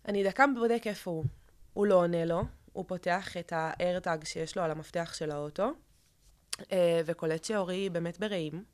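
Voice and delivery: ordinary talk, nothing marked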